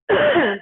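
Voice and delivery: sharp cough to clear the throat. Real, not acted. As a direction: clear your throat hard. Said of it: "I'm sorry, I'm choking".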